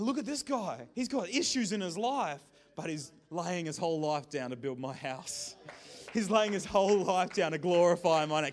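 loud, proud praise; proud Jesus. Acted. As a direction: boast about him to the crowd. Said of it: "guys going".

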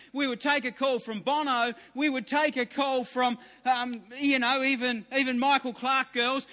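ordinary talk, nothing marked

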